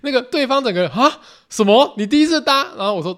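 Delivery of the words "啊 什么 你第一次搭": shocked staff. Retold or imitated. Ground staff learns it's his first flight, higher, louder shock. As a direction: gasp it out in disbelief.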